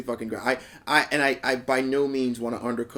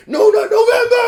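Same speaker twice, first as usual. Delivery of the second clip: huge high excited yell; hype shout; 14 dB louder than usual. Retold or imitated. Volunteer yelling to the audience, big high shout. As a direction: scream it to the whole crowd. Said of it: "turns around to the audience and goes".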